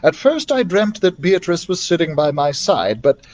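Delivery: ordinary delivery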